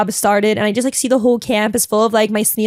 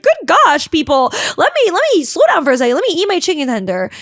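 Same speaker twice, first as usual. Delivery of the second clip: high, fast flustered plea; exasperated plea; 6 semitones higher than usual. Retold or imitated. Servers clearing while she eats.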